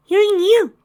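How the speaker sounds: put-on very high, loud child's voice; high-pitched hedgehog kid asking; small boy. A narrator's reading. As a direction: pipe up like a little boy. Small hedgehog boy asks newcomer.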